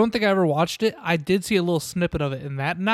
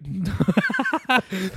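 laughing; 3 semitones higher than usual. Real, not acted. Laugh after Scared Straight.

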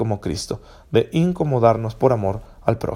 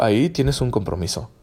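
ordinary delivery

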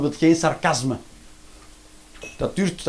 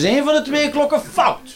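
much higher, shouting with laughter; rising comic rant; 10 semitones higher than usual. Real, not acted. Comedian ranting about clocks to the audience, shouting. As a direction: build to an exasperated yell.

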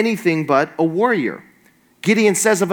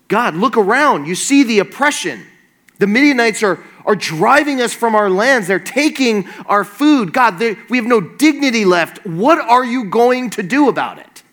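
higher-pitched, pressing protest to God; indignant complaint; 5 semitones higher, 3 dB louder than usual. Acted. Gideon confronting God over his oppressed people, indignant. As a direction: plead and accuse, pitch raised throughout. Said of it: "the Bible doesn't capture Gideon's inner monologue".

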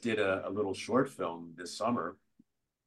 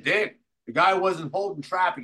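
raised, louder protest; excited complaint to the director; 9 semitones higher, 7 dB louder than usual. Retold or imitated. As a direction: defend yourself loudly.

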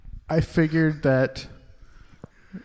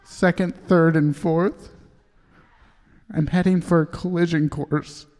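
higher-pitched, halting poem reading; choking up; 6 semitones higher than usual. Acted. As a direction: start to choke up as you recite. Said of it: "Sorry, I get choked up".

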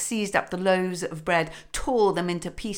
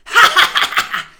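breathy cackle far up in the voice, much louder; hag cackle; 13 semitones higher, 15 dB louder than usual. A narrator's reading. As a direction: let the old hag shriek with glee. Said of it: "laughed the old hag".

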